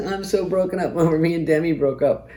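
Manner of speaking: high, hammed-up fake sob; mock crying. Mock heartbroken guy posing crying for the photographer. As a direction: whine it like fake grief. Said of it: "full waterworks".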